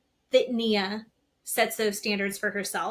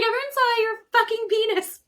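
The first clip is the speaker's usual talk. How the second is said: much higher, louder mock shriek; retold outburst; 12 semitones higher, 6 dB louder than usual. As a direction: belt it out like a scandalised cry. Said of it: "She's like".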